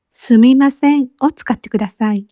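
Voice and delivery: ordinary delivery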